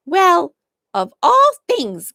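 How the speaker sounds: high, breathless gasp of shock. A narrator's reading. The cook reacts to the twins, gasping. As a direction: catch your breath in surprise. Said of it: "gasped the fat colored cook".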